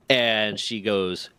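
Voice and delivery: ordinary delivery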